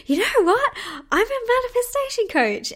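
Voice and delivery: high-pitched, thrilled retold announcement; excited reveal. Telling the saleswoman she's a manifestation coach, excited. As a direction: blurt your big reveal, voice way up high.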